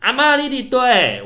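high, loud call across the hillside; slow, stretched shout into the woods; full-throated shout. Retold or imitated. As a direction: yell for grandma at the top of your lungs. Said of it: "我就扯开喉咙大喊".

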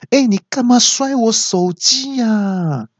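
very high, drawn-out mock outrage; angry woman. Phone owner berating the man who dropped it, mock anger. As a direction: imitate an irate woman caller. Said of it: "那小姐就很生气的说".

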